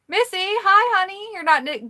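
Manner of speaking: high, loud, delighted hello; excited greeting. Real, not acted. Host greeting a viewer who just joined, excited and high. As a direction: call out a high-pitched hi to a friend.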